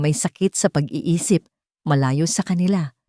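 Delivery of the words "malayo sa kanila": usual speaking voice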